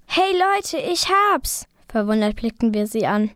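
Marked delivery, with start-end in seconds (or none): 0.1-1.7 much higher, eureka outburst; bursting-in excited announcement; excited call. A narrator's reading. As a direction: call out the sudden discovery, high-pitched. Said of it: "sprang Liv auf und rief".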